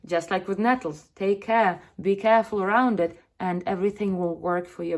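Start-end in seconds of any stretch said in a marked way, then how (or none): none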